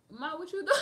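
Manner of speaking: mock protest. Protesting the big portion.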